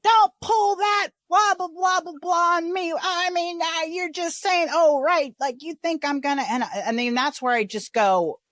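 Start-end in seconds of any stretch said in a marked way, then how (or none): 0.0-6.4 Coco's rant. Retold or imitated. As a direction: mimic a worked-up woman shouting back. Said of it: "she'll be like".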